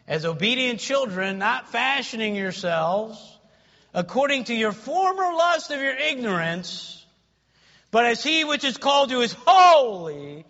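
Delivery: building scripture reading, rising to a loud, high peak; forceful pulpit reading; preaching scripture. Real, not acted. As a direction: proclaim the verse, driving up to the last word.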